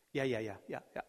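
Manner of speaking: brushing it off with a quick string of yeahs; dismissive yeah yeah. Retold or imitated. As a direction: wave the thought away, half-listening. Brushing off his own advice.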